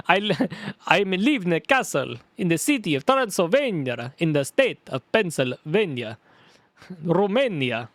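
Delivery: hammed-up Transylvanian accent. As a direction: boast like a castle dweller with a Transylvanian accent. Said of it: "in that Transylvanian voice".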